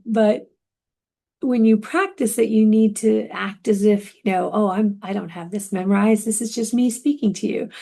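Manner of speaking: usual speaking voice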